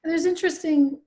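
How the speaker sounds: ordinary talk, nothing marked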